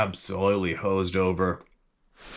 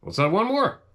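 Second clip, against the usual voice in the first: high, breezy let's-have-another; tossing off one more round happily; cheerful; 8 semitones higher, 3 dB louder than usual. Retold or imitated. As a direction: tourist ordering another beer, high and cheerful.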